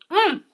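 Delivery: high, loud, savoring hum of pleasure; a big happy mm at the first bite; delighted food moan. Real, not acted. Tasting her pancake live for viewers, a loud delighted mm.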